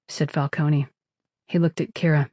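ordinary delivery

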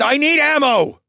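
loud yell while running. A narrator's reading. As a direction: shout it back over the noise. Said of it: "I yelled".

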